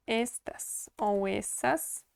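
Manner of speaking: stretched out, each word spaced; slow it down so students catch it; slow careful listing. Shown as a demonstration. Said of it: "No geral".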